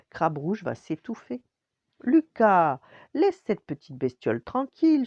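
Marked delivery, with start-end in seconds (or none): 2.0-5.1 fed-up mum raising her voice; exasperated mother. A narrator's reading. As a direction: call the boy off with weary impatience. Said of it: "soupire sa mère".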